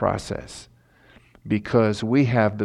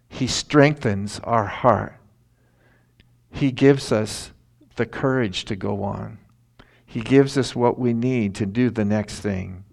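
normal talking voice

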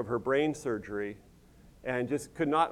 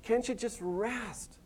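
soft, slow, higher and tender; gentle plea; 6 semitones higher, 8 dB quieter, slower than usual. Retold or imitated. The Lord gently urging busy believers to rest, soft and slow. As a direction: coax them to stop and rest. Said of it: "the Lord's just saying".

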